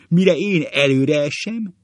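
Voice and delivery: high, loud, excited shout; acted overexcited storytelling; hysterical re-enactment. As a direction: cry it out mid-tumble. Hysterical re-enactment of school prank.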